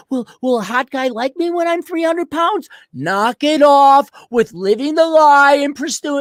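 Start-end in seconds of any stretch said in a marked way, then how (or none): 0.1-2.7 mock pleading girl. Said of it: "a fat chick saying".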